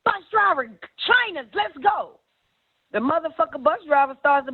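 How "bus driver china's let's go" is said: high, excited shout-out to the bus; hyped party call. Retold or imitated. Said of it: "Then she gets up".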